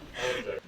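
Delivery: bursting out laughing; can't stop laughing at it; helpless laugh. Real, not acted. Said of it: "I'm sorry I'm laughing so hard".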